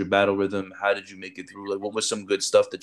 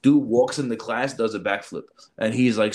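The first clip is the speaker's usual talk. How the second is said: ordinary talk, nothing marked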